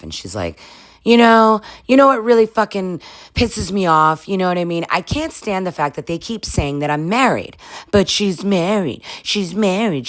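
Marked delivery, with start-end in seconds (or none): 1.0-7.6 imitating a fed-up wife's rant; mock angry rant. Imitating Amanda venting to West, mock fury. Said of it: "she's really pissed off". 7.9-10.1 higher, slower mock whine of the complaint. Imitating Amanda whining about the other woman, mocking. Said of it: "she's really pissed off".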